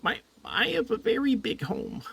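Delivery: high, gentle little voice; high little creature voice; tiny creature voice. Acted. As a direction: chirp it like a foot-tall friend. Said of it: "He says".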